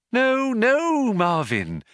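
high sing-song reassurance; over-bright soothing; lilting. A narrator's reading. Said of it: "lilted Trillian".

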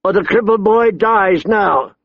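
high, urgent shouted ultimatum; shouted threat. A narrator's reading. Big man threatening the hostage boy, shouting. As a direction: shout it as a desperate order. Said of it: "the big man shouted".

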